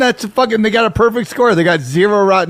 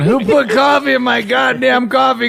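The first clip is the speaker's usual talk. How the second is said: slow, hammed-up angry rant; mock outrage; 7 semitones higher, slower than usual. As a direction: play a furious drinker betrayed.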